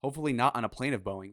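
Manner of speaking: ordinary talk, nothing marked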